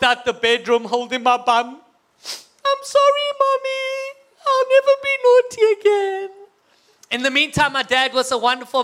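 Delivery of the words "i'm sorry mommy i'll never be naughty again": put-on high, wailing little-boy apology; fake tearful child. Boy pretends he was beaten, fake tearful wail. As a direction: wail it high as a badly faked sobbing kid. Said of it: "look like you've been crying".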